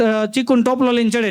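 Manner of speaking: ordinary talk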